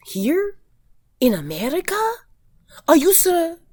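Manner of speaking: high, incredulous questions; shocked disbelief. Retold or imitated. Retelling the Colombian girl's reaction, high shocked disbelief. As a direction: mimic her stunned disbelief. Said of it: "she was very shocked".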